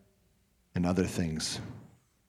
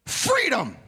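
proclaiming freedom; 4 dB louder than usual. Real, not acted.

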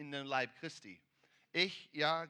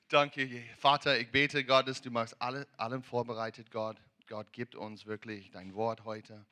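closing prayer; 5 semitones lower, 3 dB louder than usual. Acted. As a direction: pray to the Father for the word of the day. Pastor prays to close.

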